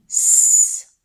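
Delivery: "S" as a loud, long hiss; isolated sound. Shown as a demonstration. Teacher isolates the first sound, "S" hissed loudly. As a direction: hiss "S" as sss, not the letter name. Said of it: "Et pour finir".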